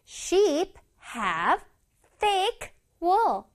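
one word at a time with pauses; slow model reading. Shown as a demonstration. Teacher reading an example for children, word by word. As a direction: space out every word clearly. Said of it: "다시 읽어볼게".

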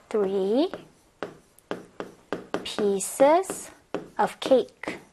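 "three ... pieces ... of cake" in separate pieces with long pauses; slow dictation. Shown as a demonstration. Teacher writing out the corrected answer, slow dictation. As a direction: dictate "three pieces of cake" word by word, rising until the last part.

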